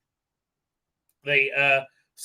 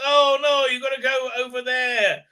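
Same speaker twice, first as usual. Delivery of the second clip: high, raised-voice mock warning; mock alarm; 8 semitones higher, 5 dB louder than usual. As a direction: cry a hammed-up warning. Acted warning in retold scene.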